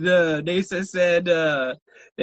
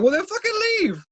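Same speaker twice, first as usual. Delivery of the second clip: mock retort; 11 semitones higher than usual. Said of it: "and I'm like".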